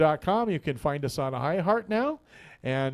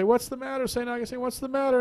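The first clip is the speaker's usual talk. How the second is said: higher worried call, concerned monks; 10 semitones higher than usual. Acted. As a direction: call down anxiously, pitched up. Monks calling down to Augustine.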